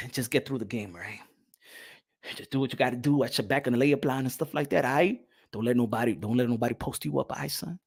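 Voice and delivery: low, soft, fast fatherly coaching; father coaching son quietly before the game; quiet dad advice. Retold or imitated. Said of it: "And I'm like".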